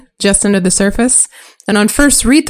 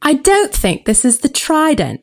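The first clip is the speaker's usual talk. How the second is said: put-on higher, light girl's voice; a girl doubting, read aloud; lightly voiced young girl; 8 semitones higher than usual. Arya voiced by a friend, doubting the river. Reads Arya's lines.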